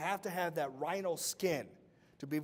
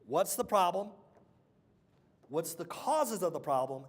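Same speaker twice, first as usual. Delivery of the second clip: ordinary talk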